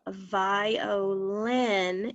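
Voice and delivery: "violin" slowly drawn out in three syllables; slow syllable breakdown. Shown as a demonstration. Teacher setting up a sound swap, "violin" slowly broken apart. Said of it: "the syllables are broken apart".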